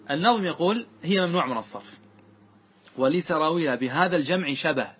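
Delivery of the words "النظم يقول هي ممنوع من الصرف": ordinary talk, nothing marked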